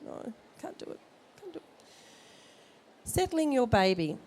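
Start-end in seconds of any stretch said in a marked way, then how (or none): 0.0-1.6 whispered, much softer mock protest; a new mum refusing help, whispered; mock helpless whimper. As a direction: whisper it like you've given up. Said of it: "I can see these people going".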